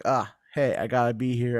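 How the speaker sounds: ordinary talk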